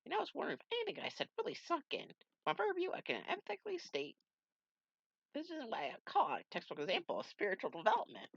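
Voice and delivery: high put-on voice for an old mentor. Mentor greeting Knuckles, in a high put-on voice. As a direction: play the wise old master, pleased.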